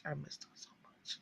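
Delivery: breathy whisper; soft whispered yearning; whispered longing. Real, not acted. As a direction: whisper it like a confession. Pining for the actress.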